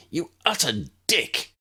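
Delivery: high mock shout of anger; angry insult. Draco cursing his father, angry high shout. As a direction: spit the insult at him.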